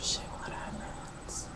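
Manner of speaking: very soft, slow whisper to yourself; real muttered self-question, barely audible; whispered aside. Real, not acted. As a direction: whisper it under your breath, trying to remember. Asks herself, lost in the story.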